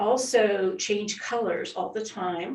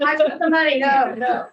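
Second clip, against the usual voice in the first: mock protest; 4 semitones higher, 8 dB louder than usual. Playfully voices guest's protest.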